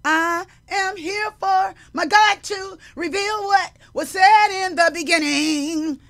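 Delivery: slow, high, loud declaration; proclaiming shout. Retold or imitated. Husband declaring his calling, loud and solemn. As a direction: announce it like a called preacher. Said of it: "he said".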